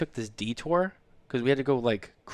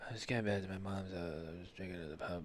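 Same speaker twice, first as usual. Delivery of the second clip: much softer, low, faster tipsy mumble; imitating drunk wife; 4 semitones lower, 15 dB quieter than usual. Husband imitating his wife calling home tipsy from the pub. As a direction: sound sheepishly tipsy. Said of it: "You're like".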